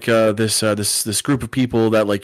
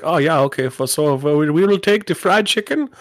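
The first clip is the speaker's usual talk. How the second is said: higher-pitched bad Swedish accent turning German; mock German-Swedish executive; German-sounding Swedish accent; 5 semitones higher than usual. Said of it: "I don't know why he's German".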